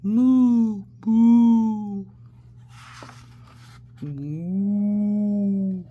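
loud cow moos; loud playful mooing. Shown as a demonstration. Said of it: "Let's give it a go".

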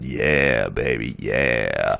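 very low, slow crooning bass. Pretend.

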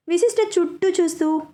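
plain reading voice

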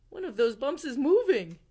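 high, loud startled exclaim; excited alarm. Acted. Owl noticing a bump move in bed, startled. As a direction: exclaim it in surprise.

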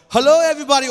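high, very loud put-on greeting; trying out a tone. Shown as a demonstration. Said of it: "I wanna know the tone".

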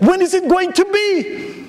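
a simple farmer suddenly interested; curious farmer. Retold or imitated. Joke, farmer finally asks.

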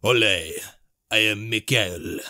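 lightly voiced Spanish accent; slow, drawn-out mock Spanish accent. Gamer voicing the Spanish version of Michael, mock Spanish accent. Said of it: "the Spanish version".

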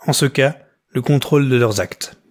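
ordinary delivery

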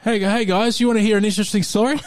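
a few beers in, getting chatty; tipsy voice. Shown as a demonstration. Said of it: "Then a few beers in".

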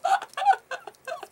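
very high, squealing giggle fit; real hard laughter. Real, not acted. Vlogger delighted by the mushroom figure, laughing hard. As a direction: break up in high, helpless laughter. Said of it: "I am laughing so hard".

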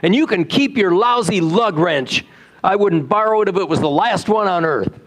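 angry outburst. A narrator's reading. City man snapping at the farmer, mild mock fury. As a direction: tell him off, a touch hammed-up.

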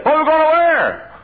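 high, loud mimicked fretting; mock worried wail. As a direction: wail it like a panicky churchgoer, hammed-up. Mimicking worried congregation's objection.

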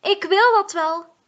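high, loud frustrated call. Acted. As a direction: cry out in protest. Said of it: "roept Mie".

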